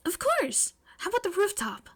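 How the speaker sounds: high, bright-eyed schoolgirl voice; eager girl pitched high; eager May. Acted. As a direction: jump at the chance, pitched high. Eager May answers Momo.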